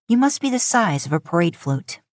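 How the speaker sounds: plain reading voice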